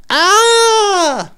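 sudden very high loud shriek; high startled shriek. Retold or imitated. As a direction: let out a shocked squeal. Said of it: "She just goes".

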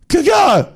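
high, explosive mock tennis grunt; tennis grunt imitation. Host mocking women's tennis, mock grunt. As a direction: yelp like a player hitting the ball.

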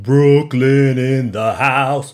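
loud, slow, put-on Brooklyn accent, drawn out; nonsense New York accent. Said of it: "I don't know where that accent came from".

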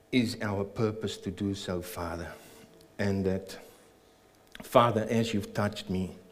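normal talking voice

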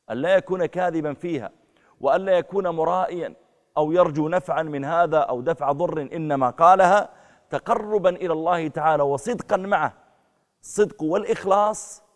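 preacher denouncing a false convert, loud and high; fiery preaching. Acted. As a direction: drive the point home to the crowd. Preaching at full force.

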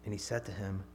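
steady, firm Bible reading; measured preacher's scripture read. Acted. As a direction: read the passage out to the congregation. Reads the Bible passage aloud.

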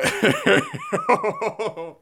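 very high helpless giggle; high-pitched laughing fit; uncontrollable laughter. Real, not acted. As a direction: crack up mid-word. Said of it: "Let me do this without laughing".

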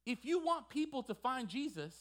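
high, emphatic pulpit delivery; impassioned preaching. Acted. Preacher telling church to make room for God, fervent preaching. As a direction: preach it hard, punching the key phrases.

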